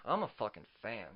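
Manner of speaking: ordinary talk, nothing marked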